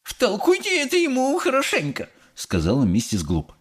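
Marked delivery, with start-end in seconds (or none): 0.0-2.1 very high-pitched prim order; huffy high woman's voice; indignant mother. A narrator's reading. Mother urging Wonka to scold helper, indignant.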